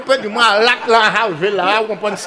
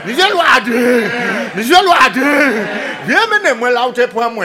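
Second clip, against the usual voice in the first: pig calling out; 4 semitones higher, 5 dB louder than usual. Acted. Pig calls out to the hunter.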